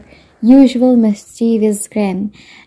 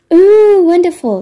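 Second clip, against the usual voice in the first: delighted; 9 semitones higher, 4 dB louder than usual. Acted. Grandma delighted by lunch offer.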